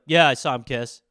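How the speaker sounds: low and matter-of-fact. Retold or imitated. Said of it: "The guy be like".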